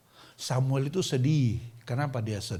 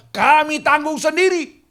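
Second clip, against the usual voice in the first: much higher, louder and slow; defiant mass reply; defiant crowd shout; 13 semitones higher, 12 dB louder than usual. Retold or imitated. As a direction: shout it back as a stubborn crowd. Crowd answers Samuel defiantly.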